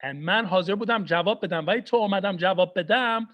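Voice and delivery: ordinary delivery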